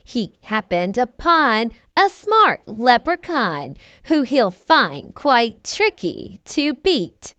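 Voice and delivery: usual speaking voice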